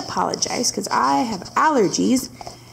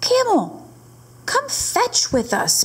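dog calling out, higher, slow on the ask; barking dog; 12 semitones higher than usual. Acted. Dog inviting camel to play fetch, eager and barky. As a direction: call him like an eager dog. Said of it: "barked Dog".

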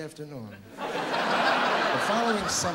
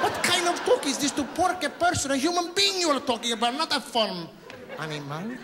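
high-pitched, scandalized rant; mock outrage; 9 semitones higher, 3 dB louder than usual. Comedian scolding a crude husband for how he talks of his wife, mock outrage. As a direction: burst out in indignant protest, pitched way up.

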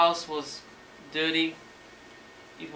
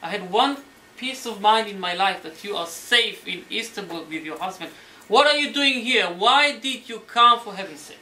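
retold fatherly yelling; 4 semitones higher, 9 dB louder than usual. Father meets Sarah at the gate and starts yelling, building. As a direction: imitate a scolding father, building to loud, high outburst. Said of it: "starts yelling to her".

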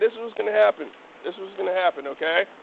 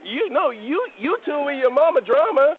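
shouting reprimand; 7 semitones higher, 7 dB louder, slower than usual. Real, not acted. Officer still shouting at the man.

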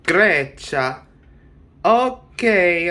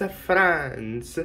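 ordinary talk

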